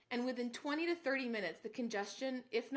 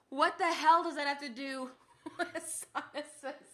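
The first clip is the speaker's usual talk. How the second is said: laughing; 3 semitones higher, 5 dB louder than usual. Real, not acted. Laughing at herself.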